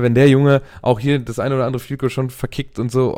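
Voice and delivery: usual speaking voice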